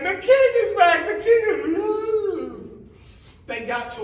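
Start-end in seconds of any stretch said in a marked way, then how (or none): 0.0-2.5 high, loud cheering shout; crowd shouting. Acted. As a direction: cheer him home. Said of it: "The people were shouting".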